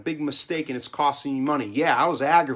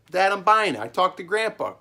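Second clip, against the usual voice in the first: put-on defiant young self; 6 semitones higher, 5 dB louder than usual. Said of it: "I go".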